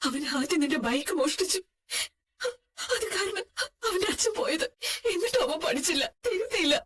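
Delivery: broken, tearful grieving; halting words between sobs; crying. Acted. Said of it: "ഇങ്ങനെ കരയുന്നത് നിർത്ത്".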